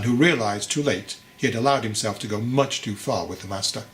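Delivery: ordinary delivery, nothing marked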